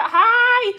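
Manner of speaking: high, loud hello; bubbly squealed greeting; excited greeting. Real, not acted. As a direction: call out a delighted hi. Greets viewer Sarah.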